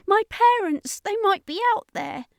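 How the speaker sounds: put-on a little boy pleading to run after his parents; panicked child crying out. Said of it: "he cried".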